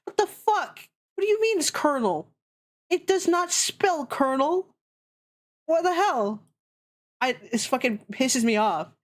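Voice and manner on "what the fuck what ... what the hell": exasperated. Real, not acted. Exasperated rant at English spelling.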